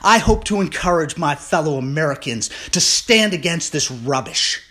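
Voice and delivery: building from firm to a loud, high furious rant; real outrage, shouting it at the cowards. Real, not acted. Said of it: "this is where I get furious".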